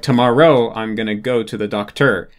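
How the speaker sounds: second-language speaker stressing wrong syllables, tomorrow as to-mor-ROW; misplaced stress. Shown as a demonstration. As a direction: land on the ends of tomorrow and doctor. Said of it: "put the accent on the wrong word".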